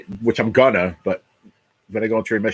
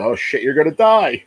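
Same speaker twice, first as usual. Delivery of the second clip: higher-pitched imitation of shocked people; imitated horrified reaction, pitched up; mock alarm; 7 semitones higher, 4 dB louder than usual. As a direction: blurt it like a stunned onlooker. Voicing people's reaction to his diagnosis.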